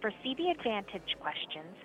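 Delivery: ordinary talk, nothing marked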